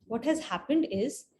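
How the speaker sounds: ordinary delivery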